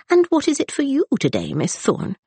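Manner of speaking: high, nosy friendly question; a lightly voiced inquisitive lady; curious matron. A narrator's reading. Mrs Buckland asks Rosalind.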